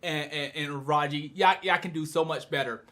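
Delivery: low, soft letdown; disappointed. Retold or imitated. Viewer let down by Gakuto and Raji, disappointed. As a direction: chide them quietly, voice sunk. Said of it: "I was very disappointed. I was really mad".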